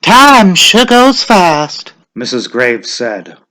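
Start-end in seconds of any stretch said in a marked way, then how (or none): 0.0-1.9 much higher, slow woman's voice; slow high old woman; old village woman. A narrator's reading. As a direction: sigh it like a weary old neighbour. Mrs Graves voice.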